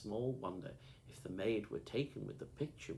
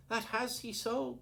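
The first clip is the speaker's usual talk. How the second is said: cried out; 6 dB louder than usual. A narrator's reading. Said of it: "cried Alan".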